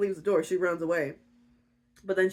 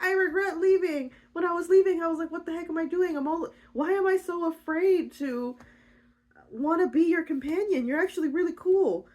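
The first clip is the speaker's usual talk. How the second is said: high, gushing confession; hammed-up earnest reassurance; pleading heroine; 10 semitones higher than usual. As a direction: pour it out in a high-pitched plea. Rhea pleads with hurt Orpheus.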